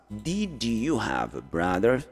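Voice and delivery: very slow, drawn-out "Did", rising at the end; slow modelling. Shown as a demonstration. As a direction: model the question slowly for learners. Teacher models past question slowly. (pergunta bem devagar, alongando "Did")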